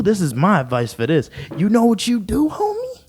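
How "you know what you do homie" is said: high hyped voice. Acted. Hyped advice to the viewer.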